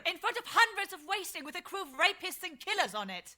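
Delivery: high, furious retort; angry shout. Acted. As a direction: throw it back at him in rage. Heated quarrel with the narrator.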